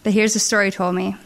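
ordinary delivery

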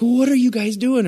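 put-on high, amazed question from the window girl; a cashier's stunned high voice; surprised cashier. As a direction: ask it wide-eyed and puzzled. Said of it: "She's like".

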